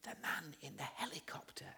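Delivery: put-on hushed, tiny child voice; little boy's whisper. Little boy answering who the fireman is with, whispered. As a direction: whisper it softly, as if hiding. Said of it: "and then the little voice says".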